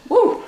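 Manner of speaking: short, very high "woo"; high whoop. Real, not acted. Cook signs off a cooking step to viewers, high whoop. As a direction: squeal a quick falsetto woo.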